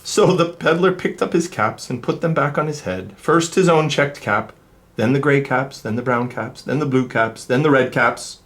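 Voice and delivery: lively, sing-song storybook reading; reading to children. A narrator's reading. Reader telling kids the peddler story, animated. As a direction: read it like to a class of little ones. Reads picture book aloud.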